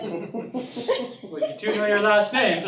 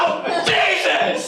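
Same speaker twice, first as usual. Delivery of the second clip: shocked shout; 13 semitones higher, 6 dB louder, much slower than usual. Acted. Shocked by the reveal.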